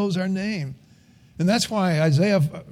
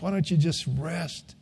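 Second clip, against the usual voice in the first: soft and fading, much quieter; God speaking tenderly to his children, softly; tender God's voice; 5 dB quieter than usual. Acted. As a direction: coax gently, like soothing a tired child. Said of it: "he's saying to us".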